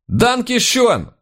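high, drawn-out holler of thanks; loud thanks. A narrator's reading. Junior officer thanks the German hosts, loud high call. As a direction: belt out clumsy German in a high, stretched voice. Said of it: "громко подтвердил мои слова наш младший сотрудник".

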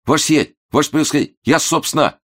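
high, frightened babble; babbling nervously. A narrator's reading. The startled clerk babbles to the noble guest, high and nervous. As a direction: stammer it, flustered and scared. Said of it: "залепетал".

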